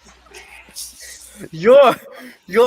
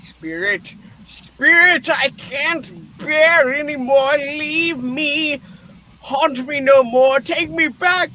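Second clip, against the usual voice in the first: desperate pleading; 5 semitones higher than usual. Acted. Scrooge pleads with the spirit to take him home, desperate. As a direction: beg the spirit, breaking up at the end.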